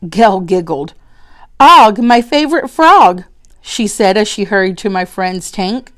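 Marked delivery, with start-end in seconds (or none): 1.6-3.2 excited. Acted. Child greets frog excitedly.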